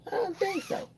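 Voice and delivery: high, soft sassy no; sassy refusal. Retold or imitated. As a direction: turn the doctor down with attitude. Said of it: "But I'm like".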